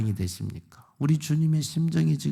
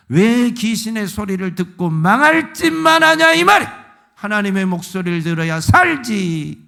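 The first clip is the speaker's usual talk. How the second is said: loud, high, driving sermon rebuke; preacher thundering at the congregation; full-force preaching; 8 semitones higher, 6 dB louder than usual. Acted. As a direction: hammer it home from the pulpit.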